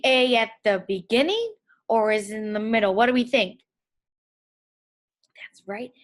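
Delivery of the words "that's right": whispering. Real, not acted. Praise to young kids.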